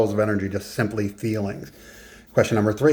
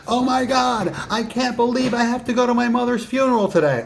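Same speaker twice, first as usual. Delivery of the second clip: much higher, bubbly excited voice about sad news; mismatched excitement; 13 semitones higher than usual. Shown as a demonstration. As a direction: sound thrilled about a funeral. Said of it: "you wouldn't be headbanging to that".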